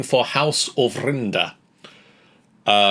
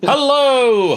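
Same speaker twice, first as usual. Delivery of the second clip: loud greeting; 13 semitones higher, 6 dB louder than usual. Real, not acted. Stretched opening greeting.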